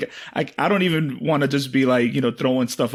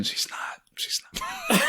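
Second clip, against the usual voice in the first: much softer, confiding; hushed aside; 8 dB quieter, slower than usual. Real, not acted. Comic admits his partner isn't listening, hushed aside. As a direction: drop to a near whisper.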